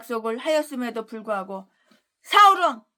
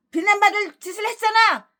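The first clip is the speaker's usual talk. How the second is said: higher, louder rebuke; scolding retort; 8 semitones higher, 4 dB louder than usual. Real, not acted. Preacher scolding his critics, sharp rebuke. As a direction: snap the rebuke back at them.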